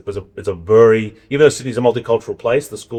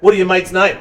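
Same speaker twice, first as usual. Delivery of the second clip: dad asking; 9 semitones higher, 5 dB louder than usual. Retold or imitated. Father asking son on phone.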